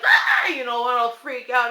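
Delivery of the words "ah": very high short shriek; startled shriek. Retold or imitated. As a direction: shriek as if jumped by a scare. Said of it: "I'll be like".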